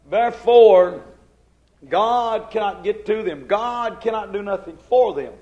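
loud, fervent preaching. Acted. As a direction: thunder it from the pulpit. Preaching at full force.

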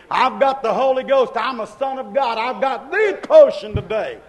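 fired-up preacher driving each phrase home; full-force preaching. Real, not acted. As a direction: build to a shouted declaration.

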